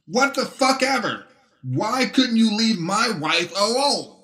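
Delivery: high, strained furious voice; losing his temper. A narrator's reading. Betrayed husband confronting his wife's lover, high and furious. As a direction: rage pouring out at his rival. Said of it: "now losing his temper".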